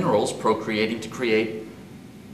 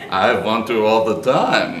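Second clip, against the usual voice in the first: laughing; 8 dB louder than usual. Acted. Playful flirting, laughter.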